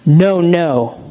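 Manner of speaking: flat-out correcting, no sweetness; stern original tone. Shown as a demonstration. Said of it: "same words said differently".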